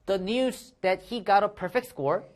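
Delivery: very slow, careful read-aloud; slow model reading. Shown as a demonstration. Teacher reading the example sentence aloud, slowly. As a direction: read it out slowly for students to repeat.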